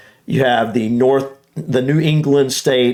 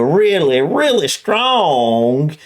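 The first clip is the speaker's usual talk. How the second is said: strong Alabama accent; slower than usual. Shown as a demonstration. Showing a listener the strongest Southern accent, slow Alabama drawl. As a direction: stretch every vowel in a thick Alabama drawl. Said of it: "goes something like this, of talking very slow".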